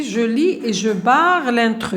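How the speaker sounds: slow, word by word; slow instruction. Shown as a demonstration. Teacher reading the sixth activity instruction, slowly. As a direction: read the task slowly to the class. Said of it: "sixième activité".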